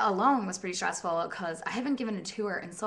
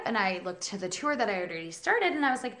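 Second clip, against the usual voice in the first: normal talking voice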